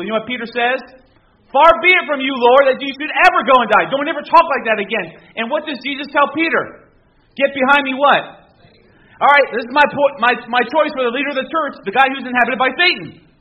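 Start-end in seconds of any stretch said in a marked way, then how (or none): 1.5-5.1 mock protest. Peter rebukes Jesus, retold. 7.4-13.3 loud, raised preaching voice; full-force preaching. Acted. Pastor to congregation, loud preaching.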